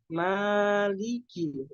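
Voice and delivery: "Maliki" chanted slowly with thin vowels; smiling pronunciation. Shown as a demonstration. Teacher corrects the rounded mo, slow smiling "Maliki". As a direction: smile through "Maliki", each vowel drawn out full. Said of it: "harus seperti tersenyum".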